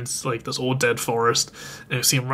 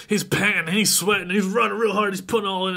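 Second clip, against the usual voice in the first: higher, worked-up telling; mock straining effort; 6 semitones higher than usual. Retelling a child running real hard, mock straining. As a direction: narrate as if straining along with the runner.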